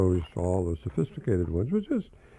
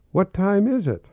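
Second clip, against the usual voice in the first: put-on child voice; 10 semitones higher, 4 dB louder than usual. [name] asks while playing.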